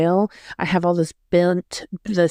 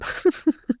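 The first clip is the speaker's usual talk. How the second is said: short laugh, much higher; 11 semitones higher than usual. Real, not acted. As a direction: let out a quick high laugh. After story punchline.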